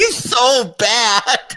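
very high, slow, stretched-out laughing cry; laughing exclamation. Real, not acted. Co-host cracking up over Mancow, laughing exclamation. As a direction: wail it helplessly through laughter.

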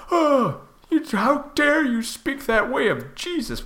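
high, slow scandalised pearl-clutching; mock offended believer. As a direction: gasp it like a shocked churchgoer.

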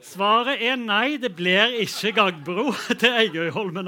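loud, emphatic announcement; announcing the result. Real, not acted. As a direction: proclaim the verdict to the room. Host announces result to the room.

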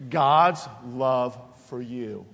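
drawn-out God's love for you, stressed on God's; slow emphatic preaching. Real, not acted. Pastor pressing a truth on the congregation, slow and emphatic. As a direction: stretch every word of it.

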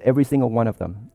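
usual speaking voice

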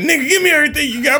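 high, rushed mock stick-up; acted mugger. Joking about a New York mugging, hammed-up robber. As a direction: demand it fast in a high voice. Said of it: "run up on you with a knife".

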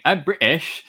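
jokey stab at a British accent; bad British accent. Shown as a demonstration. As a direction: do a deliberately terrible British accent. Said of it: "my only British accent is me saying".

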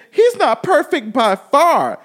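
mock [name] quote. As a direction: drag it out like [name], mocking her. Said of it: "[name] really got on her and said".

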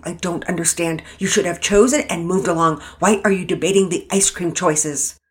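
loud, exasperated scolding; angry dressing down. Acted. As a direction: give them a furious telling-off. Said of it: "Mama Peters was fit to be tied".

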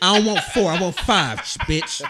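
laughing mock demand; mock bossy husband. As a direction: play the picky husband, half laughing.

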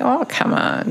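high, airy mock Satan voice; a brief whispered jab of a mock devil; Satan tempting. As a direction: sneer it like the tempter. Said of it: "And Satan's like".

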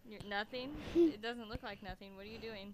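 hushed, gentle questions; soft voice. Real, not acted. Mother talking softly to child.

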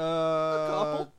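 very slow put-on voice; slow drawn-out. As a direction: stretch every word out.

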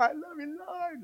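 a soft, high, fading prayer in the car; private car prayer. Retold or imitated. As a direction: murmur it to yourself alone in the car. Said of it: "You could be in your car".

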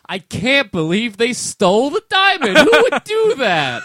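high, loud fake outrage; mock loud outcry. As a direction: shout it like a bad actor. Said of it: "you have to loudly shout".

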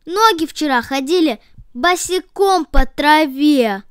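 petulant complaint; grumbling. A narrator's reading. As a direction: hands tattling on the messy legs. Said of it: "продолжали ворчать".

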